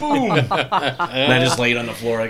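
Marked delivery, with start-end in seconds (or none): 0.0-0.3 sound-effect boom. Retold or imitated. Falling face first.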